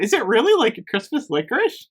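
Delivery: ordinary delivery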